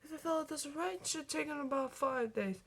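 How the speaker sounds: soft, unhurried musing; thoughtful. Acted. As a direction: Doc thinking aloud. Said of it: "Doc said thoughtfully".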